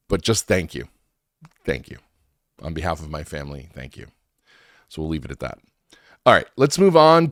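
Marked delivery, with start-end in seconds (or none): 0.1-4.1 softening to a near hush; sincere, fading thanks. Real, not acted. Repeated heartfelt thanks, fading.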